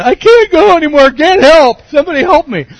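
high, loud, panicked cry for help; panicked yell for help. Retold or imitated. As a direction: yell down from the pipe, stuck and terrified. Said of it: "I'm yelling".

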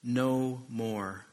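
low, slowing elder's comfort; solemn reading. Acted. Elder comforting weeping John, low and solemn. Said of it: "one of the elders said to me".

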